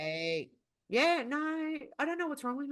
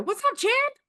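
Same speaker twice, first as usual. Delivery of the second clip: high mock-friendly greeting; 4 dB louder than usual. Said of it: "they'd start a meeting and go".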